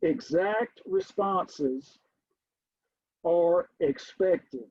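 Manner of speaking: slow, deliberate statement; measured classroom dictation. Shown as a demonstration. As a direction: lay it down slowly as a rule. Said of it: "let me write this down".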